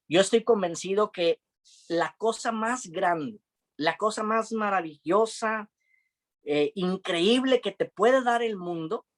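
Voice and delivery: slow, high, drawn-out preaching build; impassioned preaching. Acted. Pastor urging the congregation at the close, slow impassioned preaching. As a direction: lean into each phrase like a closing altar call.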